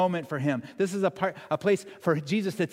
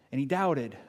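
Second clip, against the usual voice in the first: ordinary delivery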